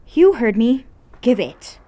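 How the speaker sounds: loud and high, no nonsense; snatch it with your voice; girl ordering friend to hand it over; bossy demand. Acted. An orders him.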